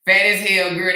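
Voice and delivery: ordinary talk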